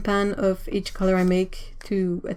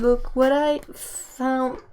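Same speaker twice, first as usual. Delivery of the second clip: cooing; 4 semitones higher, slower than usual. Real, not acted. Repeated coo to kitten.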